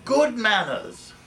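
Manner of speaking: slow, high, loud prim reply; prim retort. Retold or imitated. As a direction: deliver it primly and slowly. Viv's deadpan retort.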